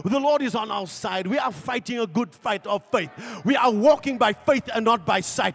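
loud, rising sermon cry; preaching shout. Acted. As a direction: declare it to the whole church, loud and certain.

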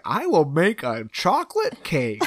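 slow, high put-on voice; mock earnest girl. Mocking the girl who bakes at a party, slow earnest announcement.

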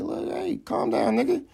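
high put-on voice for a condescending hush; mock Dennis Schroeder. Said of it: "was just like".

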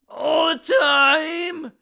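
sudden high, loud, slow yell; shouting. Real, not acted. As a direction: blurt it out in exasperation. Irritated outburst.

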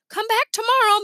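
lightly called goodbye; calling out. A narrator's reading. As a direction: call it out as they leave. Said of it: "Missus A called".

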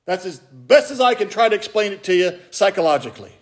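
ordinary talk

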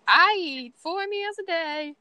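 put-on high, slow little-girl voice; Lilo impression. Fan quoting Lilo in a high child voice to her friend. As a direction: imitate Lilo earnestly listing her good habits. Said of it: "especially when Lilo's like".

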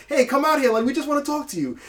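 high, coaxing police callout; a cop calling someone out to talk, voiced high; mock bullhorn cop trying to coax him out; imitating the cops. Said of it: "if he's talking to the cops and he's like".

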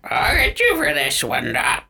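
parrot voice. Pretend. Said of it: "can you do your best parrot voice".

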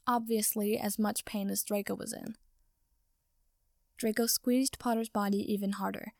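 ordinary delivery

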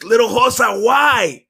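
loud, high anguished cry; desperate shouted question. A narrator's reading. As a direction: cry out to your younger self in pain. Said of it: "I cried".

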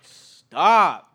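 loud fed-up dude stop; loud exasperated call to the cougher; exasperated loud plea. Retold or imitated. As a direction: blurt it out, fed up. Annoyed at coughing passenger.

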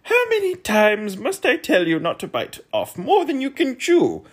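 high, loud scolding; snapped. A narrator's reading. Undersecretary snapping at the choking earl, high and loud. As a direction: tell him off high and loud. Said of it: "snapped the undersecretary".

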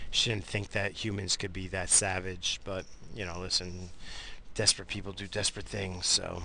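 ordinary delivery

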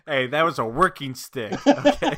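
high, loud mock outrage; mock indignant. Defending the broken stick to his friend, hammed-up indignant.

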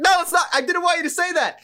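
high, loud squeal of dismay; hammed-up high-pitched protest; mock protest. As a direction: yelp it out in comic panic. Retelling his own dismayed protest.

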